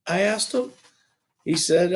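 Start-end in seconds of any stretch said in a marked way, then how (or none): none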